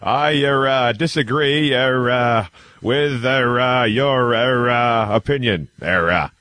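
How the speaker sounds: hammed-up JFK, hesitating on every word; Kennedy impression. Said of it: "Kennedy accent without throwing in lots of "era".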